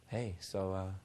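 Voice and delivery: low, soft, lifeless monotone; dreary, deadened low mumble of a proposition; mock unsmiling person. Shown as a demonstration. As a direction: drone it quietly with no smile. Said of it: "who's constantly like this".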